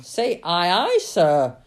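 loud captain's order; big, commanding shout at the speaker's own pitch; roaring. A narrator's reading. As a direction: roar it as a command. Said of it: "roared the captain".

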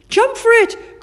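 high, loud shout; urgent call. A narrator's reading. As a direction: call out across a danger. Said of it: "called Chip".

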